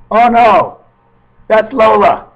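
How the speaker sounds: excited outburst. Real, not acted. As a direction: exclaim it suddenly, high and loud. Sudden outburst about the cat.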